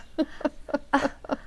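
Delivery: soft, breathy giggle with no voice; quiet tittering laugh; hushed amused giggle; breathless laugh. Acted. Mother amused by telegram.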